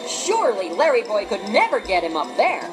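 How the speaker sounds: ordinary delivery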